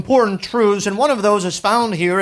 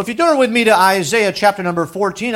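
normal talking voice

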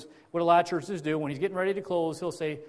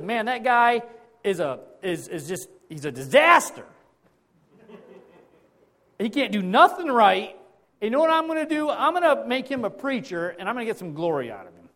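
hammed-up exasperated God; 5 semitones higher, 7 dB louder than usual. God sizing up the hopeless preacher, mock exasperation. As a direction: throw up your hands at a disaster.

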